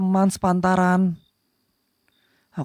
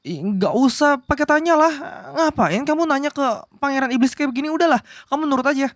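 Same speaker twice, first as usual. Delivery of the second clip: huffy flustered prince; 7 semitones higher than usual. Acted. Prince snaps, won't be questioned.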